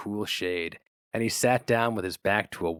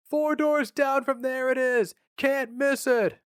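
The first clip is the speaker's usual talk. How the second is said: high, slow, louder shout; shouting over noise; 4 dB louder, slower than usual. A narrator's reading. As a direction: bellow it over the crash of steel.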